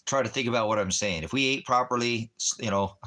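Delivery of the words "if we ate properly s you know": ordinary talk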